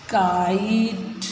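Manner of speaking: Kite drawn out slowly, each sound clear; teacher modelling kite slowly; slow model word. Shown as a demonstration. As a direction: stretch kite out slowly for the class to copy. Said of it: "अब देखो इनको फिर पढ़ो".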